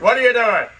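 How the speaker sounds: sharp, loud, high cop challenge; a cop yelling at a kid tagging a dumpster, loud and high; cop's challenge. Retold or imitated. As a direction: bark it like a cop with a searchlight. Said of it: "They're like".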